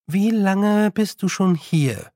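tentative and drawn out; cautious question. A narrator's reading. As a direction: feel your way into the question. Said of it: "fragte sie vorsichtig".

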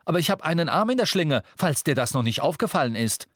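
high, heated comeback; testy, aggrieved bickering; quarrelling retort. A narrator's reading. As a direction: argue back irritably, pitched high. Friends quarrel, retort about injured arm.

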